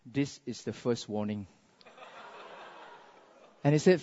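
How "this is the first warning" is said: very soft, low warning; understated menace from the wife; cool quiet threat. Acted. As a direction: drop to a deadly calm murmur. Wife coolly threatens horrified husband.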